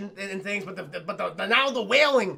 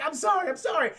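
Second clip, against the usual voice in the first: higher-pitched pleading apology; mock apology; 7 semitones higher than usual. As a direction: plead sorry in a raised voice. Retold apology before shooting him.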